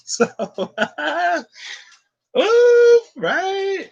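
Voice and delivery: squealing with laughter; high laughing. Real, not acted. Laughing at her own point.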